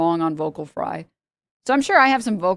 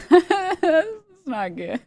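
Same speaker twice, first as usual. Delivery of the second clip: helpless high-pitched laughter; slow words squeezed through laughter; laughing; 10 semitones higher, much slower than usual. Real, not acted. Laughing at own bad impression.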